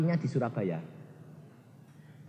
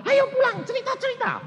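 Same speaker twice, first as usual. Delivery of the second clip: put-on high, quick, delighted old woman; surprised old mother. Mother surprised her son came from Australia, high. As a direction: greet the son in a squeak of surprise.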